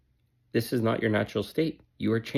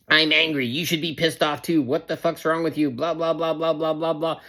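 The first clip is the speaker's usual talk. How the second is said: higher-pitched, mildly mocking tirade; mock angry rant; 4 semitones higher than usual. Narcissist blaming the listener, mock angry ranting. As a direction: rattle off a complaint in a raised pitch. Mimics angry gaslighting person.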